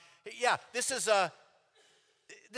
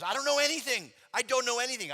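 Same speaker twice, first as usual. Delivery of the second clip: much higher and louder, protesting; insist, flustered and pleading; 9 semitones higher, 7 dB louder than usual. Retold or imitated. Passenger protests his innocence.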